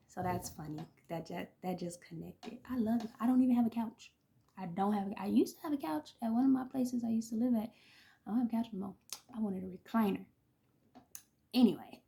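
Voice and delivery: ordinary talk, nothing marked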